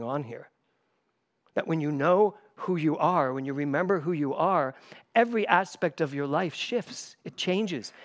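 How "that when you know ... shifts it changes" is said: normal talking voice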